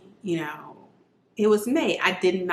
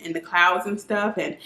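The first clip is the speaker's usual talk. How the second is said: usual speaking voice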